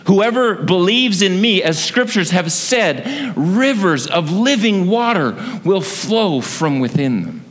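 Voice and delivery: high, forceful proclamation; half-shouted, high-pitched imitation of a public cry; retold cry out. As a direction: call it out over the festivities. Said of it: "The scripture tells us he cries out".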